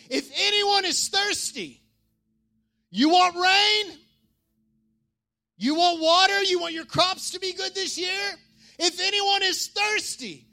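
very high, stretched-out calling voice; shouted proclamation. Acted. Jesus shouting to the festival crowd, high and drawn out. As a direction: call out high to a whole crowd. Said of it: "he shouted in a loud voice".

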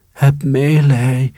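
high, slow squeaky plea; pleading mice. A narrator's reading. Trapped mice begging the cat, high slow squeaky plea. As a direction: beg for mercy like tiny mice. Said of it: "riepen de muizen".

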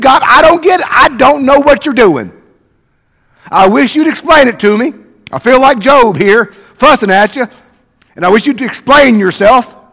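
loud, exasperated pleading with God; frustrated honest prayer. Retold or imitated. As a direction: fuss at God like Job, raw and loud. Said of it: "sometimes we gotta pray this".

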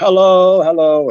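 high, loud, drawn-out friendly hail; called-out greeting. Retold or imitated. Villagers greeting him as they walk past, called out. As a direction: imitate villagers calling hello in passing.

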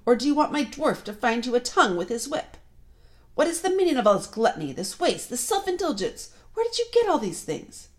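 high, loud interrogation climbing in pitch; threatening witch queen. A narrator's reading. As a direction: demand answers with rising outrage. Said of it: "she said again".

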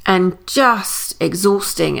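very slow, dragging delivery; sluggish and slow. Real, not acted. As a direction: drag the words out wearily. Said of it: "my body is mimicking it".

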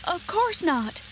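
put-on high little-girl voice; Dorothy. A narrator's reading. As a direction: answer as an alarmed young girl. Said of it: "answered Dorothy".

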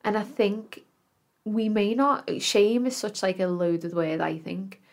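ordinary talk, nothing marked

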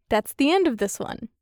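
usual speaking voice

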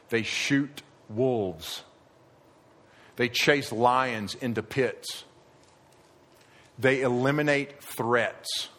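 very slow and measured, each word weighed; slow emphatic. Acted. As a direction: lay each duty down slowly. Shepherd duties for emphasis.

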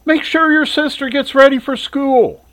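mother tells her son to get his sister ready, high call. Acted. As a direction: call the order up the stairs. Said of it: "Shirley called up the stairs".

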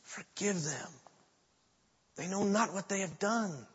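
soft, reverent, not a real scream; hushed cry. Retold or imitated. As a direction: breathe it out softly. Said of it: "he screams out".

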